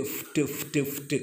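"ഫ്റ്റ്" breathed out softly, consonants only; teacher isolating the ending of "ലിഫ്റ്റ്" for pupils; quiet cluster drill. Shown as a demonstration. Soft repeated ending sound. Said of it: "teacher isolates final cluster".

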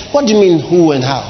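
usual speaking voice

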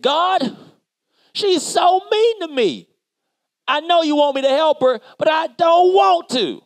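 whiny complaint. Retold or imitated. Complaining to God.

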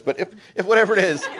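usual speaking voice

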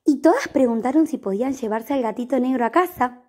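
ordinary delivery, nothing marked

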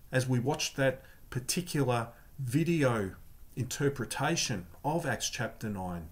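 usual speaking voice

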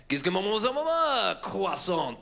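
high French-sounding gibberish landing on one real word; exaggerated French impersonation; mock French noises. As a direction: fake French babble, rising then drawn out. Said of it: "I make French noises and then drop in".

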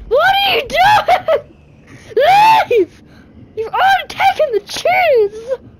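high frightened shrieking with laughter; panicked scream. Real, not acted. Chef attacks, player panics.

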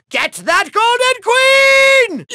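high, drawn-out battle cry; slow, rising villain yell; shouted command. Acted. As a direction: order the minions to charge. Villain orders his minions to attack.

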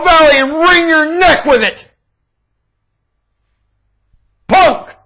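high, loud yelled insults; yelling insults. Real, not acted. Insulting the people of Egypt, a furious high yell. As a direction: spit the insult out, shouting.

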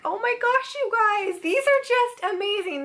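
high, delighted oh my gosh; showing the viewers her new cards, pitched high; excited gushing. Real, not acted. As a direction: gush like you just found treasure. Gushing over scrapbook cards.

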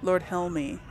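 normal talking voice

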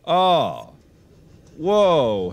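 ordinary delivery

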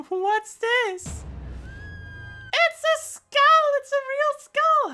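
very high panicked cry; shrieking girl voice. Pretend. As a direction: shriek it like a scared heroine. Heroine shrieks at skull.

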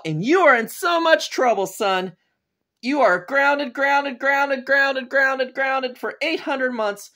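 high-pitched, mildly hammed-up telling-off; grounding rant. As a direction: hammer out the punishment as an exasperated dad. Father grounding son in a rant.

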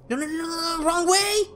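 high frantic no no no; panicked cries while running. Real, not acted. Fleeing the monster.

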